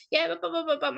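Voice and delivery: high, tumbling buts; excited stammer. Retold or imitated. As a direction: trip over your words in excitement. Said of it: "like, hyper and happy".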